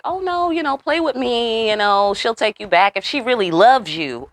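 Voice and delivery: put-on very high, coaxing girly voice; very high mock cooing plea; mock sweet temptress.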